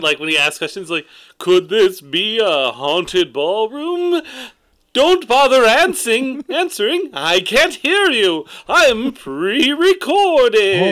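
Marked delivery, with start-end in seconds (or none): 1.4-10.9 higher, louder, very slow ghost voice; hammy haunted recording, drawn out; spooky recorded ghost host. Pretend. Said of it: "you can ask it".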